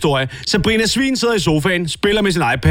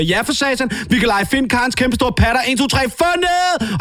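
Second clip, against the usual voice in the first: high, cheeky brat voice yelling insults; a bratty little sow mouthing off; foul-mouthed piglet; 7 semitones higher than usual. Acted. Piglet daughter taunting her dad in a high bratty voice. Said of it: "Sabrina siger".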